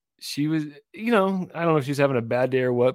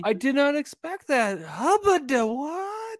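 mock amazement; 8 semitones higher, 3 dB louder than usual. Acting out a friend surprised by pizza, mock amazement. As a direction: play up astonishment at an unexpected gift.

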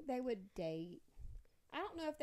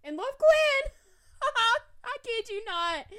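high, loud laughing disbelief; excited shriek; 11 semitones higher, 16 dB louder, slower than usual. Real, not acted. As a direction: shriek it out in giddy shock. Draws a shocking pair.

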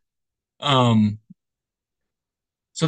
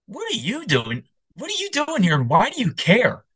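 high-pitched incredulous questioning; mock incredulous; 13 semitones higher than usual. Opera-goer questioning a young kid, mock incredulous.

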